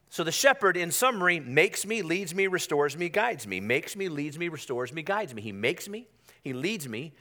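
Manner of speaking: usual speaking voice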